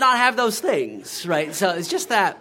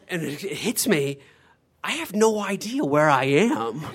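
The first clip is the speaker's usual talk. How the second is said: usual speaking voice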